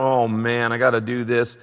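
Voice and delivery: low, dragging reluctance; mock drudgery. Preacher imitating a reluctant giver, weary drudgery. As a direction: groan it like a dreaded chore. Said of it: "It's not out of drudgery".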